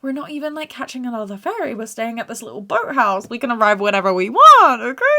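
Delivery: high, strained mock reassurance; calming them down. Soothing the parents at the repair place, strained reassurance. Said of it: "calm it down".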